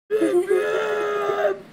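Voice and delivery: shrieking laugh; high squealing laugh. Real, not acted. Laughing after impression.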